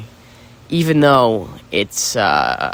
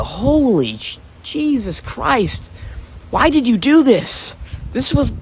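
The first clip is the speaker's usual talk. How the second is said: exasperated; 10 semitones higher, slower than usual. Real, not acted. Exasperated at his own recording.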